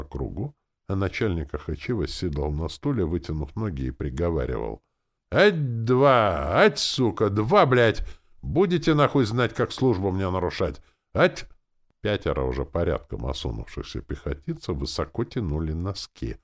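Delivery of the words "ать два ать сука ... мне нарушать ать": loud, high-pitched drill sergeant; punchy, cursing parade-ground count; drill commands. A narrator's reading. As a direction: call the step with angry force. Zombie sergeant drills prisoners.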